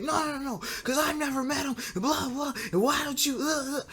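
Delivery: high, whiny mock protest; mad girlfriend. Girlfriend arguing with boyfriend about his cousin, mock mad. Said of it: "she got mad over it".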